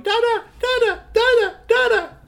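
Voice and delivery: put-on very high, loud baby babble; baby calling. A narrator's reading. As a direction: squeal it like an excited toddler. Baby calls for father.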